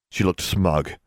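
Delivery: usual speaking voice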